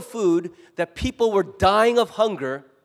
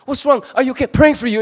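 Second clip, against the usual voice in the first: high, loud, fussing concern; a flurry of loud anxious check-in messages; alarmed friends; 7 semitones higher, 10 dB louder than usual. Acted. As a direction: gush over them like worried church friends. Life group members reacting to post.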